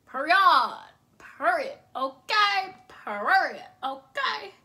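loud, sassy sign-off word; loud emphatic period. Real, not acted. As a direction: punch out period. Hyped catchphrase.

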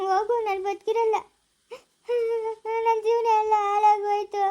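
slow, drawn-out tearful wail; acted despairing cry. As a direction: wail through sobs, stretching the words. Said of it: "ಯಾಕಮ್ಮ ಅಳ್ತಾ ಇರೋದು?".

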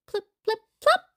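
high, popping plip-plop; high dropping sounds. A narrator's reading. Animals put a new costume together, high popping sounds. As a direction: pop out each little drop.

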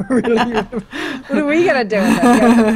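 laughing through the words; amused laugh while asking. Real, not acted. Laughing at the huge haul.

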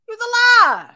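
hint of a diva rasp, belted high. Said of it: "In my best [name] voice".

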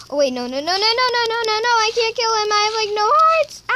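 panicked. Real, not acted. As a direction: back off in panic. Realizes low health.

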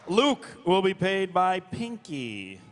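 ordinary delivery

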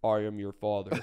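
mock-ominous low voice; deep voice. Shown as a demonstration. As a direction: put on a deep movie-villain bass. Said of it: "have a good deep voice".

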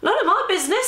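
high-pitched, airy dismissal; breezy. A narrator's reading. Nan backs out of the argument, breezily. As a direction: toss it off lightly, voice way up. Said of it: "said Nan breezily".

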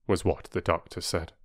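ordinary delivery, nothing marked

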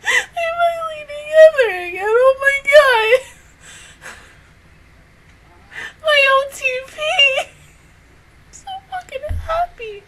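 high tearful joyful wail; happy crying. Real, not acted. Fan crying with joy that the couple reunited. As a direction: sob it out with joy.

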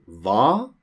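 "Hva" starting straight on a V sound, no H; silent H. Shown as a demonstration. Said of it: "we don't pronounce the H sound in hva".

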